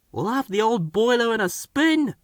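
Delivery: a hint of puffing mischief; steaming Bill. A narrator's reading. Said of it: "steamed Bill".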